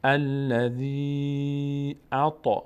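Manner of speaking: الذی اعطی recited slowly, the "ii" of الذی held long; drawn-out, level recital of الذی اعطی; measured elongation. Shown as a demonstration. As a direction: stretch الذی, then move into اعطی. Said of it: "به اندازهٔ بستن سه تا انگشت".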